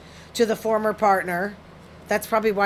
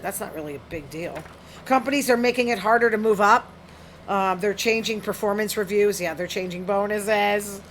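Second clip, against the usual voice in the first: ordinary talk, nothing marked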